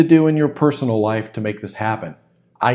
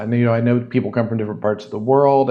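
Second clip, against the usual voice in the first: ordinary delivery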